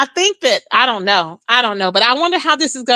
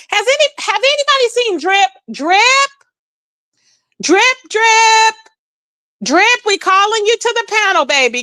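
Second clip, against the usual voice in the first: high raised call across the room; hollering for Drip to come to the panel; 7 semitones higher than usual. Real, not acted. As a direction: call him over, voice up high.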